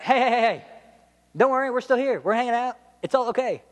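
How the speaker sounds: high-pitched, mock-urgent calming; hammed-up don't-panic call; called-out reassurance. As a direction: call over to stop and soothe someone. Prisoners call out to reassure jailer.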